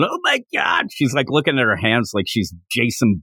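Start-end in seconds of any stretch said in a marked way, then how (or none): 0.0-0.9 freaking out. Retold or imitated. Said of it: "She ends up freaking out".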